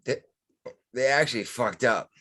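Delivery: talking through hiccups; words broken by hiccups. Real, not acted. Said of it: "I can't stop the hiccups".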